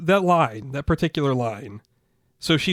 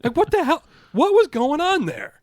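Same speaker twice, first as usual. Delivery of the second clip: baffled high exclaim; 12 semitones higher, 3 dB louder than usual. Real, not acted. To a friend about the pen lady, high incredulous. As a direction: throw up your hands at the absurd.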